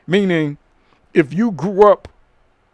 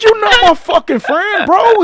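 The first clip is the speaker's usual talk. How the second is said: higher and louder, hammed-up indignation; retold angry yell; 12 semitones higher, 7 dB louder than usual. Comic to the Facebook stranger at the back door, mock angry yell. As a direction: bark it back like you're fed up.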